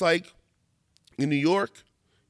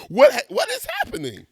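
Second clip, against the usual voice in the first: much higher, louder stunned question; disbelief; 6 dB louder than usual. Real, not acted. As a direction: ask it in outraged disbelief. Disbelief at red-flag posts.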